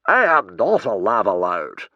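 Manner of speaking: loud, firm denial; indignant protest. A narrator's reading. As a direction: deny the accusation with offence. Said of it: "protested the man indignantly".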